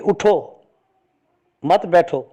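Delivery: اٹھو, a clear stop, then مت بیٹھو together; pause after اٹھو. Shown as a demonstration. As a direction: break after اٹھو, keep مت joined to بیٹھو. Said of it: "اٹھو پر وقف نہیں کرتا". (اٹھو کے بعد وقفہ)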